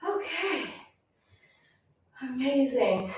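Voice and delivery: winded after the workout; out of breath. Real, not acted. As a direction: talk while catching your breath. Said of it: "I'm out of breath".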